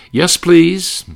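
sweet innocent singer voice. Retold or imitated. Naive tenor answering politely, imitated high. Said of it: "[name] might say".

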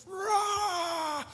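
high excited rah; fired-up roar. Retold or imitated. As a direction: roar like you're pumped up. Fired-up listener roaring.

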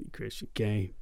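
low, soft imitation of the stuntman's accent; Ramon's accent. Actor imitating the tired stuntman calling his name, low accented voice. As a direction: murmur it in the cowboy stuntman's accent. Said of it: "he's got a really great accent".

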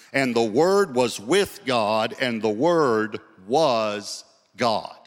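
slow, weighty verse with long-held words; emphatic scripture reading. Real, not acted. Preacher proving Jesus is God, slow emphatic scripture reading. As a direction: read scripture slowly, landing on each key word.

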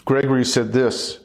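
ordinary talk

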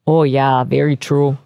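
hammed-up Minnesota officer; Minnesota accent. Officer agreeing with the shooter, in a mock Minnesota accent. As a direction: play it very Minnesotan. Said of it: "the most Minnesota-sounding accent".

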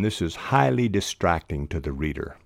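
ordinary talk, nothing marked

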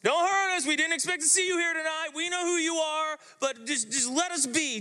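high, whiny begging demon; a frightened demon's high, pleading whine. Acted. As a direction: squeal like a cornered demon pleading for mercy. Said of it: "this demon starts going".

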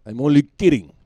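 high, very loud comic call; loud joking disclaimer. Pretend. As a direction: holler that it was a joke. Loud disclaimer after the laugh.

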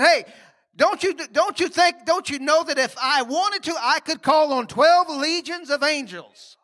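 high, slowing, insistent voice of Jesus; Jesus, emphatic. Retold or imitated. Preacher quoting Jesus to the congregation, high and insistent. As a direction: press it home, rising high. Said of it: "Jesus said".

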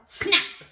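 sharp, high, loud burst; crocodile snap. Pretend. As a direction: snap it like closing jaws. Imitates crocodile's jaws in story.

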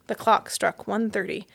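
usual speaking voice

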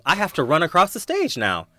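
loud, high-pitched, urgent outburst; mock panic. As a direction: cry out as if dreading the dash. Acted panic over sleeping leg onstage.

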